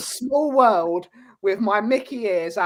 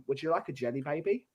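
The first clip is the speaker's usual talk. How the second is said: a softer take on Tom Baker's Doctor Who; light Doctor Who voice, quieter; 4 semitones lower, 9 dB quieter than usual. Retold or imitated. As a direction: offer it softly like the Doctor. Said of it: "went full Tom Baker's Doctor Who".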